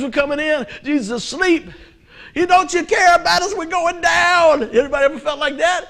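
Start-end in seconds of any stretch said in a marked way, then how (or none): 2.3-4.6 high, loud frightened cry; desperate plea over the storm; panicked disciples. Retold or imitated. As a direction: shout it like the boat is sinking.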